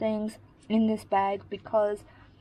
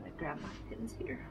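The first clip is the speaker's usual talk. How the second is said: soft whispered aside; whispering; 13 dB quieter, faster than usual. Real, not acted. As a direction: whisper it to yourself. Quick aside while grabbing pins.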